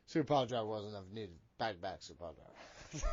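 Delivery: put-on low, slurred old drunk voice; old drunk guy. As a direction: slur it like a barfly grumbling. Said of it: "Just sound like an old drunk guy".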